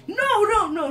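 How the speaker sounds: high, loud panicked no no; panicked shout. Real, not acted. Gamer losing the fight, loud panicked shout. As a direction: cry it out in alarm.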